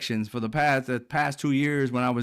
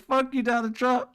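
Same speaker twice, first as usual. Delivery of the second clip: high squeal through laughter, mocking; squeaky mock Nick Fuentes; 10 semitones higher than usual. Vlogger mocking pundit's rant at Trump, high squeaky mimicry. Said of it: "he came out and said".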